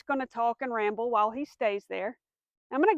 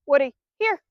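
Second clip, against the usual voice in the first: firm recall call; 6 dB louder than usual. Shown as a demonstration. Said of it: "I say".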